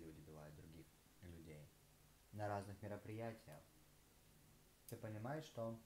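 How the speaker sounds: talking between bites; tags: chewing while talking. Real, not acted. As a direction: mouth partly full of food. Seen 3: Bites a green slice at 1.7 and 7.0, chews between.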